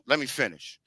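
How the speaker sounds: quiet firm repeat to an interrupter. Real, not acted. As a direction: drop the voice but hold the floor. Interrupted, insisting on finishing.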